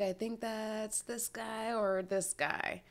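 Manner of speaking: unsure guessing. Acted. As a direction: drag it out, guessing between lookalike faces. Acting out guessing among lookalike members.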